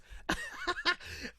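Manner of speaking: sudden high burst of laughter; real amused laugh. Real, not acted. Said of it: "I'm laughing at it".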